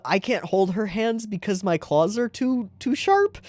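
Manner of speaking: put-on high, sad girlish voice. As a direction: say it with shy sadness. Voicing character's sad line.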